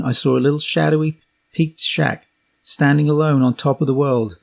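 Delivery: plain reading voice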